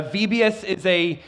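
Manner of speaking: ordinary talk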